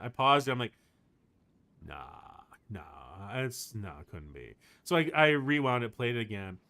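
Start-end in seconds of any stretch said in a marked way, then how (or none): 1.9-4.5 low, quiet talking to yourself; muted disbelief. Retold or imitated. Fan pauses the song, doubting her hunch, muted disbelief. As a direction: dismiss the idea under your breath, dropping lower. Retold disbelieving talk to herself.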